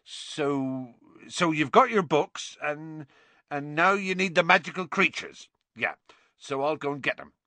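halting, repetitive, flustered explanation; losing the thread. A narrator's reading. Hagrid teaching a first lesson, losing his thread. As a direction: stumble along, groping for the next step. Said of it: "seemed to have lost his thread".

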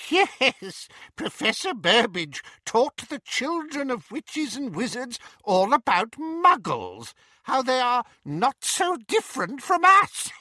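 high, sneering contempt; mocking Voldemort. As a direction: sneer at the idea.

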